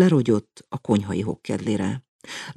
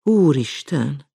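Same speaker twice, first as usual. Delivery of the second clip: sighed; 7 semitones higher, 4 dB louder, slower than usual. A narrator's reading. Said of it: "sóhajtotta mama".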